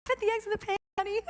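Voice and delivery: high, sing-out mom nagging; strained sweetness. Retold or imitated. Retold mother losing patience, feigning sweetness.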